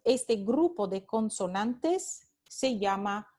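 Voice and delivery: ordinary delivery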